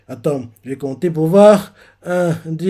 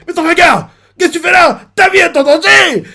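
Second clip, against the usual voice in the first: high, loud frantic cry; alarmed pirate shouting; panicked warning; 13 semitones higher, 6 dB louder than usual. Retold or imitated. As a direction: burst in warning of danger.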